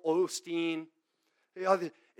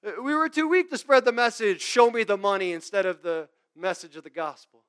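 loud, higher mock humility on weak; sarcastic Paul; 5 semitones higher, 12 dB louder than usual. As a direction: lean on weak with scorn. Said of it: "He says sarcastically again".

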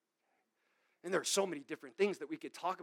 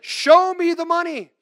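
loud, high, slow mock chant of show me the money; mocking greedy preacher; 12 semitones higher, 16 dB louder than usual. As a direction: belt it out like a greedy televangelist. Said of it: "that's what they say".

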